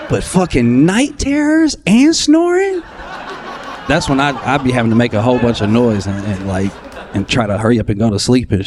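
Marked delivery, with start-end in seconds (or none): 0.1-2.8 high, slow, exasperated whine. Acted. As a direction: stretch it out in disbelief, pitched way up. Exasperated comic punchline.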